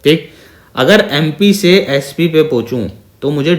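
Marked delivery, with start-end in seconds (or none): none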